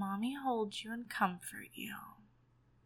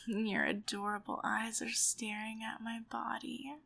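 normal talking voice

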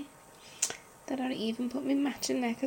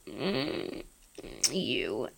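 ordinary talk

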